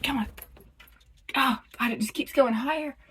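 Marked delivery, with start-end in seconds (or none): none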